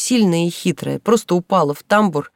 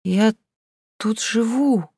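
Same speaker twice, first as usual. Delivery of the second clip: mumbled, cotton-tongued; 4 semitones higher, much slower than usual. A narrator's reading. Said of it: "пролепетала Инка ватным языком".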